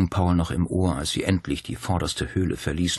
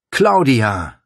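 loud, raised call of a name; 7 semitones higher, 9 dB louder than usual. A narrator's reading. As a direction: call her back urgently. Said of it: "rief er".

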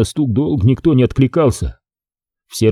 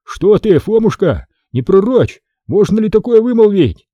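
pleading; 9 semitones higher than usual. A narrator's reading. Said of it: "взмолился".

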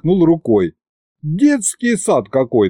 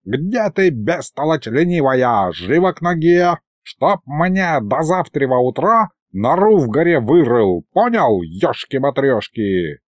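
put-on scolding old sorcerer; 2 semitones higher than usual. Old sorcerer threatens lazy pupil with a belt, scolding. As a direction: scold like a cranky little old mage.

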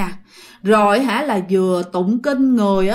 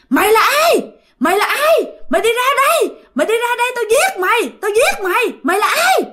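very high, hammed-up threatening scream; furious yell; 11 semitones higher than usual. Possessed mother screaming threats at her own child, high acted yell. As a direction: shriek the threats like a possessed woman. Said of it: "mẹ bạn lại la lớn hơn nữa".

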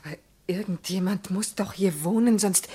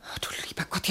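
whispering; 8 dB quieter than usual. Acted. Tense whisper in strange house.

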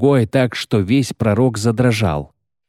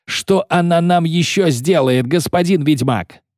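high-pitched, heated demand; agitated yelling; 8 semitones higher than usual. A narrator's reading. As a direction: yell the question at the witcher. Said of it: "орал, размахивая руками". (возбуждённый крик)

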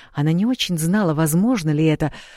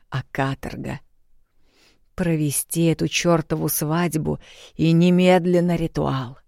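plain reading voice

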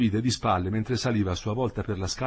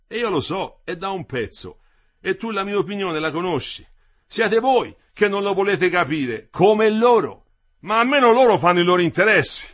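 high-pitched retort, building louder; worker arguing back; 9 semitones higher, 9 dB louder than usual. Acted. Worker answering Quinto's politics by the fire, argumentative. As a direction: push back, louder towards the end.

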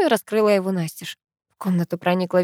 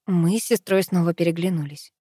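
plain reading voice

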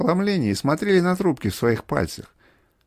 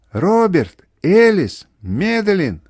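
loud, higher cries, one name each; calling out; 4 semitones higher, 7 dB louder, much slower than usual. A narrator's reading. Mother crying for Роберт, Элис, Мэдалин, loud calls. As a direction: shout the names in alarm. Mother shouting for her children.

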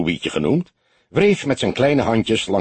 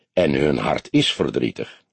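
light mockery; 7 semitones lower than usual. A narrator's reading. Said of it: "In zijn stem trilde een lichte spot".

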